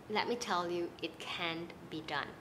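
normal talking voice

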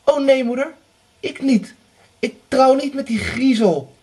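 high, loud frightened cry. Acted. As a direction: recoil in horror at the idea. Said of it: "zei Anna verschrikt".

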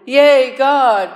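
loud, high celebratory shout; joyful cheer. Acted. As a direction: cheer it to the whole congregation. Repeats the inmate's cheer to congregation.

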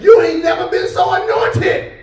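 loud, high triumphant reply; God answering. Acted. God answering Paul's complaint, loud and triumphant. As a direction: proclaim it like a joyful answer. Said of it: "God said".

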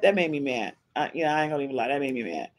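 ordinary talk

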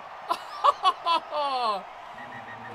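long, loud burst of laughter; exasperated laugh. Real, not acted. Gamer laughing at the clown's blunder, exasperated.

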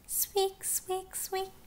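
high-pitched little mouse squeak. Acted. Imitating mice in the story.

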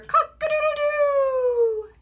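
high, loud, drawn-out crow; rooster crow. A narrator's reading. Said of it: "He can sound like a rooster".

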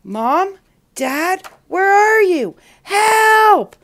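high, loud calls for help; frightened shouting. A narrator's reading. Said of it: "as he shouts again and".

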